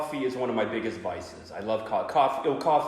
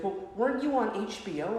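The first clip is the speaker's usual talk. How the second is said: niece voice; 10 semitones higher than usual. Retold or imitated. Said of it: "she goes".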